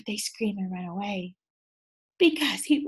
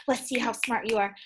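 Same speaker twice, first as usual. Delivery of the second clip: ordinary delivery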